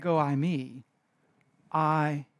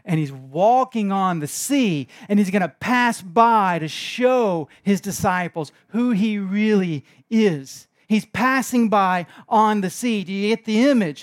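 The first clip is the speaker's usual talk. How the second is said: full-force preaching; 3 semitones higher, 6 dB louder than usual. Acted. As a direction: drive home the image of Jesus on the sea. Building preaching climax.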